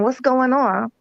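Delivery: ordinary talk, nothing marked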